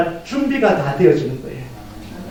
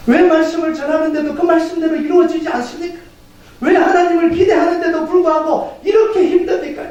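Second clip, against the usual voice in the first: high-pitched, insistent protest to God; acted anguished prayer; 9 semitones higher than usual. Preacher acts struggling church questioning God, anguished pleading.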